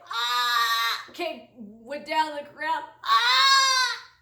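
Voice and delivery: loud, high mock yelp; mock cry of pain. Kid hit by the football, mock pained yelp. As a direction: yelp like you just got hit.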